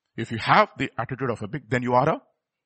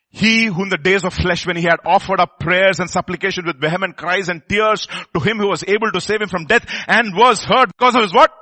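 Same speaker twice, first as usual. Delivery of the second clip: loud, raised scripture recital; forceful pulpit reading; scripture preached; 5 semitones higher, 7 dB louder than usual. Real, not acted. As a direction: proclaim the verse to the congregation.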